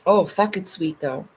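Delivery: ordinary delivery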